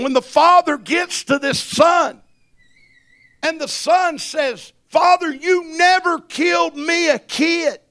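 loud, rising preacher's cry; full-throated revival preaching; preaching at full force. Acted. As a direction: drive it home to the congregation. Preaching builds again.

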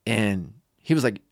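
ordinary talk, nothing marked

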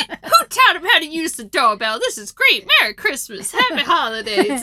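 loud, high hammed-up glee; playful acted holiday cheer; delighted party host. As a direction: gush festively as an overjoyed host. Continues the delighted host's exclamations.

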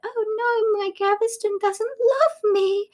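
high-pitched mock heartbreak; slow down into a high, hammed-up lament; dismayed queen. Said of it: "she realizes just generally that".

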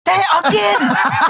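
high, drawn-out laughing shout; exasperated outburst. Real, not acted. Host insisting a co-host is wrong, exasperated high shout. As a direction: holler it slowly in mock outrage. Heated dispute over earlier conversation.

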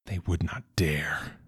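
hushed unvoiced inner whisper; whispered thought. A narrator's reading. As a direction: think it aloud in a whisper.

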